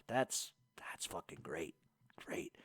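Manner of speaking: hushed, mock-impressed whisper; sarcastic whisper. Real, not acted. Host reacting to a viewer threat, whispered sarcasm. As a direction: breathe it out like a sarcastic aside.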